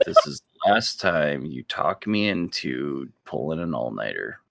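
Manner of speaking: low, resigned sigh of a character; weary sigh. Acted. Falanthier to companion after being talked into it, weary sigh. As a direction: sigh it out wearily, voice dropping low. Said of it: "just kinda sighs and is like".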